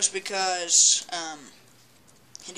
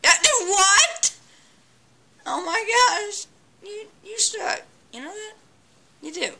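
mocking taunt; 12 semitones higher, 4 dB louder than usual. Real, not acted. Mocking the enemy in game.

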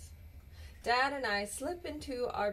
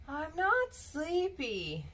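put-on sleepy child; 7 semitones higher, slower than usual. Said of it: "you tell me".

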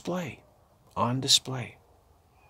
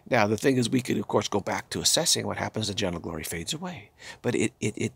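ordinary talk

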